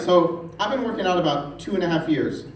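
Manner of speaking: ordinary talk, nothing marked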